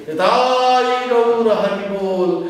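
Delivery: Nitai Gaur Hari Bol called out loud and high, slowly. Shown as a demonstration. Monk showing devotees the high loud call, slow and ringing. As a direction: shout the kirtan call with full voice. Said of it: "So your high voices say".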